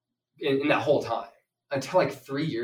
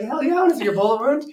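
higher, louder, fast and thrilled; eager excitement; 12 semitones higher, 9 dB louder, faster than usual. Retold or imitated. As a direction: jump at the chance, all in. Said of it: "I'm like".

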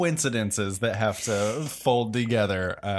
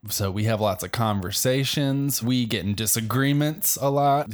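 ordinary talk

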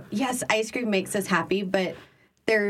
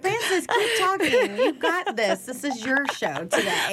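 mock cohost encouragement; 5 semitones higher than usual. Imitating cohost cheering her on.

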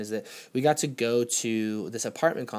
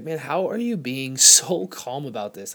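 amazed laugh; 2 semitones higher, 6 dB louder, slower than usual. Retold or imitated. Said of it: "And I was like".

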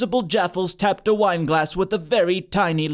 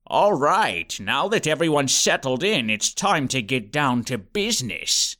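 lower, sinister turn; ominous principal; 6 semitones lower than usual. A narrator's reading. Principal gets serious.

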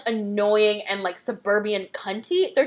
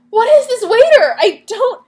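loud, high-pitched exasperated cry; high, loud incredulous yell; baffled outburst; 11 semitones higher, 10 dB louder than usual. Real, not acted. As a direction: exclaim in total bewilderment. Baffled outburst about the waiter.

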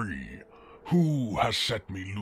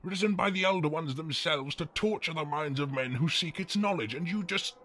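higher-pitched, indignant demon rant; exasperated outrage; 5 semitones higher, faster than usual. Acted. Demon learning a burp summoned him, exasperated indignant rant. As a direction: lose all grandeur in an incredulous tirade.

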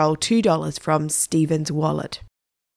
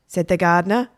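plain reading voice

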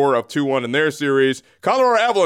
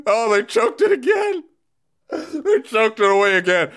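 gleeful mock wail; 9 semitones higher than usual. Mocks team choking amid laughter.

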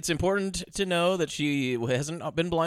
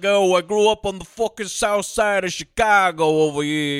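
a louder mock New York tough-guy accent; 6 dB louder than usual. Mocking the actor's fake Chicago voice with a New York accent. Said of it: "fucking New York accent".

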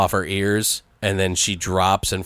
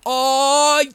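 hyped exclamation; 4 dB louder than usual. Retold or imitated. Beat drop, character exclaims.

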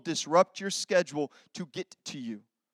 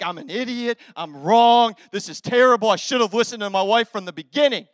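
self-scolding; 2 semitones higher, 8 dB louder than usual. Retold or imitated. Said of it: "I'm like".

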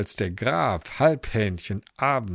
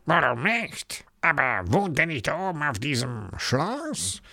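sly fox voice; 3 semitones higher than usual. Acted. Fox answers.

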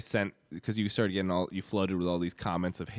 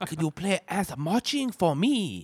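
slow, high put-on Chinese accent; mock Chinese accent; 8 semitones higher, slower than usual. Dalai Lama requests a song, mock Chinese accent. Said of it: "Not to do a Chinese accent unprepared?".

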